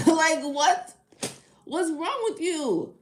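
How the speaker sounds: loud, incredulous what's wrong with you; outraged laughing disbelief; indignant. Real, not acted. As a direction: call the commenter out in disbelief. Indignant at a crude commenter.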